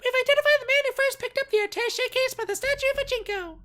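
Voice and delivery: very high, loud radio bark; radio command. Acted. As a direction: blast it over the wireless. Director over radio.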